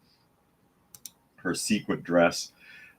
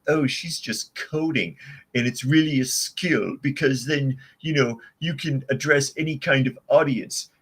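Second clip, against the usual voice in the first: sarcastic higher-pitched nerd voice; Comic Book Guy; 6 semitones higher than usual. Acted. Host mocking media excuses for coding, in a sarcastic nerd voice. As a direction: put on a pedantic cartoon nerd, higher than usual. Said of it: "doing a comic book guy from The Simpsons".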